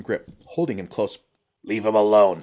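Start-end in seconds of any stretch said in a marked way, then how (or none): 1.6-2.4 loud, furious warning; seething. A narrator's reading. Old man warns captors holding the prince, seething. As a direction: seethe with anger, forcefully. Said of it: "seethed Yotsu".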